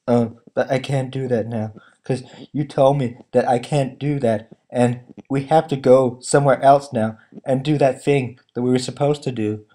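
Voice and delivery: low, soft, droning mock dialogue; mock monotone actors. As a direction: mumble it like wooden actors. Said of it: "talking like this".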